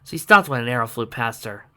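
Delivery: ordinary delivery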